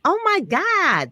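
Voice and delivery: high, loud drawn-out gasp of surprise; genuinely thrown by the news; a slow, amazed oh my God; surprised. Real, not acted. Hears guest met Oprah.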